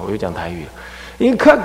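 ordinary talk, nothing marked

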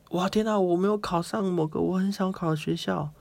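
mock despair. Imagined student's lament.

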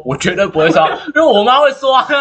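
loud, rising high into laughter; student talking back to the teacher, laughing loudly; cheeky laughing retort. Retold or imitated. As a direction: fire back at the teacher, cracking up. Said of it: "我就会说".

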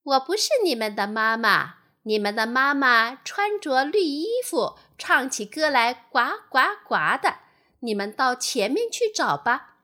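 loud storybook goose voice. A narrator's reading. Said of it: "大白鹅笑着说". (大白鹅大声地说)

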